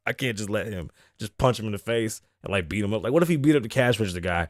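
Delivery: ordinary talk, nothing marked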